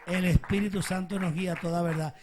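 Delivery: loud, ringing proclamation; full-force preaching. Real, not acted. Preacher declaring the Spirit's guidance to the congregation, full-force preaching. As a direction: project it hard to the whole church.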